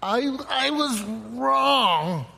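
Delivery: hammed-up, agonizingly slow admission; struggling to admit it. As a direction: drag out WRONG at great length. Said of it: "Why do we struggle so much with".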